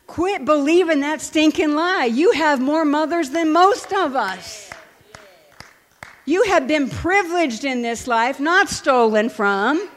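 loud, high, emphatic rebuke; forceful exhortation. Retold or imitated. Counselor setting an orphaned client straight, forceful exhortation. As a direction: drive it home like an impassioned preacher, loud and slowing on key phrases.

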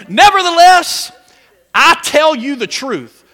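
high, loud proclaiming of the verse; loud scripture reading. Acted. Reading the key verse loudly.

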